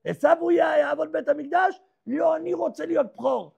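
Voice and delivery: indignant protest. Acted. Jacob protesting his brother serves.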